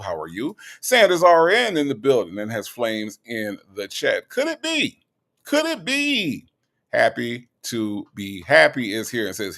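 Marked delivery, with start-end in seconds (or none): 4.3-6.5 very high, drawn-out playful call; high-pitched call-out. Pretend. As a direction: float the name up high. Playful call-out of viewer's name.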